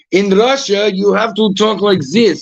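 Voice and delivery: thick mock Russian, proclaiming; Russian accent. Shown as a demonstration. Showing Russian is easy to imitate, comic Russian accent. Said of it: "Russian is hard".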